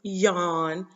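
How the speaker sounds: ordinary talk, nothing marked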